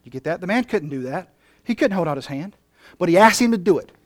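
usual speaking voice

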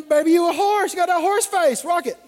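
high-pitched goofy sweet talk; mock compliment. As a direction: hype her up jokingly, pitched high. Mocks the clumsy horse compliment.

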